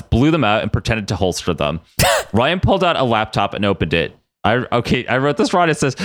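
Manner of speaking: ordinary delivery, nothing marked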